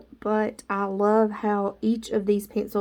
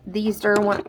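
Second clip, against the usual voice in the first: ordinary delivery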